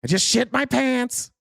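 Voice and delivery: very high, loud mock voice; hammed-up, high-pitched yelp of an impression; rough Trump impression. Showing what Trump's voice is instead, high bad impression. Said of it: "my Trump impression's not great".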